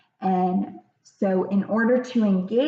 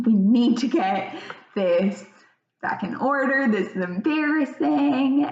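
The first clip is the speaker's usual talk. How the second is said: mildly flustered parent, pitched up; exasperated parent; 5 semitones higher than usual. Acted. Parent wanting the public scene over, mildly exasperated. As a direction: voice a parent's embarrassed urgency, lightly acted. Voices embarrassed parent's thoughts.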